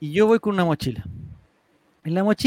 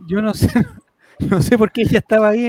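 habla entre risas; riendo; 7 dB louder than usual. Real, not acted. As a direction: cuenta la anécdota riéndose. Cuenta riendo la revisión. (entre risas)

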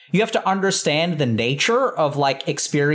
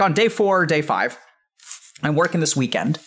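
ordinary delivery

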